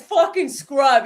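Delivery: exaggerated imitation of a friend's catchphrase; Steve impression. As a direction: stretch it out slowly and loud, mimicking. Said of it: "And then after he says".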